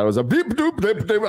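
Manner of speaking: mock gibberish accent. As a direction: burble nonsense like unclear speech. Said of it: "thick Scottish accent".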